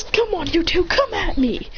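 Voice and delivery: high, goading battle voice; eager challenge. Acted. Acted challenge to two fighters.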